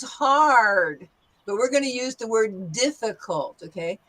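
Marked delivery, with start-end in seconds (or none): none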